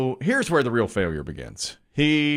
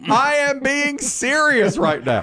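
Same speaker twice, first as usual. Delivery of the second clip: high, slow, insistent dad; retold indignant scolding; 12 semitones higher, slower than usual. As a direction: insist on being taken seriously, pitched high. Dad scolding his laughing son.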